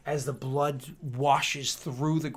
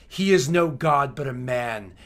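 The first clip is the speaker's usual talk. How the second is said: contemptuous declaration; 9 dB louder than usual. Acted. Swordsman declares after striking.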